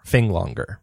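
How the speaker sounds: ordinary talk, nothing marked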